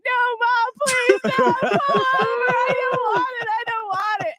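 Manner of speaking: high, drawn-out panicked pleading; terrified child imitation. Daughter begging mom to stop, terrified. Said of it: "She was just terrified".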